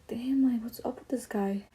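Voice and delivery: a private whisper about the rude customer; whispered aside. Retold or imitated. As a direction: mutter it to yourself. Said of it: "But I was still like".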